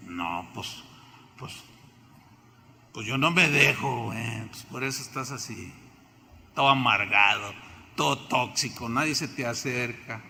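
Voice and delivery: low, mumbling stubborn tough-guy voice; a hint of a sulky hard man brushing it off; lightly voiced bitter believer, low and gruff; grumbling tough guy.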